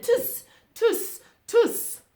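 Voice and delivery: loud, high monkey tutting; mimicking monkeys. A narrator's reading. Monkeys copying the furious peddler, loud teasing tuts. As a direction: squeal the cheeky tsks emphatically. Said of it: "stomped both of their feet back at him".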